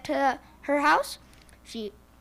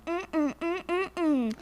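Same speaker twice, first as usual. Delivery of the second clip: hen humming; 5 semitones higher than usual. Acted. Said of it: "the little red hen started going".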